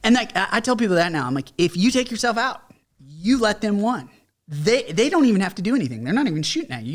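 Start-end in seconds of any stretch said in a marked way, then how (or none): 1.6-6.9 pitched up high and pressing, urging them hard; urgent plea. Retold or imitated. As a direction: plead with a struggling vet to hang on. Urging struggling veterans not to quit.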